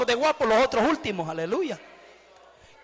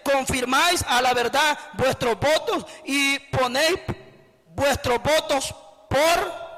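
scripture reading; slower than usual. Acted. Preacher reading the prophet's words to the congregation, slow and solemn. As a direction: proclaim each word of the verse slowly.

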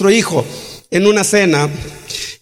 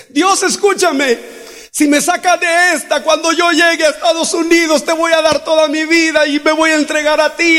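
desperate prayer; 8 semitones higher than usual. Retold or imitated. Lost man in the desert begs God to save him, high and pleading.

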